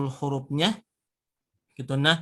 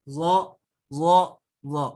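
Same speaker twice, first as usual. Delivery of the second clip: ordinary talk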